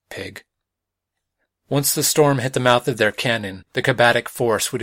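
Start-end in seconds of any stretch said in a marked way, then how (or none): 0.1-0.4 a quiet, much softer aside under the breath; a scornful word dropped almost silent; muttered insult. Acted. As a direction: mutter it so nobody else hears. Muttered insult after tense exchange.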